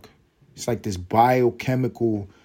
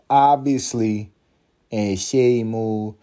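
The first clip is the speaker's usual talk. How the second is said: slow drawn-out; 3 dB louder, slower than usual. Pretend. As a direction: stretch each word out slowly.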